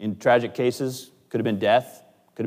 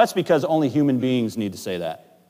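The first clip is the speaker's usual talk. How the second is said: normal talking voice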